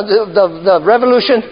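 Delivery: ordinary delivery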